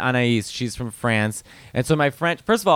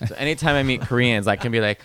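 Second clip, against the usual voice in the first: usual speaking voice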